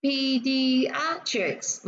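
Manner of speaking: pediatrics very slow, pe-di-AT-rics; stress on third syllable. Shown as a demonstration. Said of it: "nhấn vào âm thứ ba".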